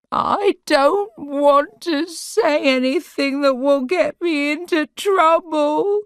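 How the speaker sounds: high, loud tearful moan. A narrator's reading. Sarah moans, bursting into tears. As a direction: wail it out in distress. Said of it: "she moaned".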